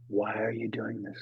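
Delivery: soft, low, inward asking; quiet question. Shown as a demonstration. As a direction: let it sink in gently. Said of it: "That was the big lesson".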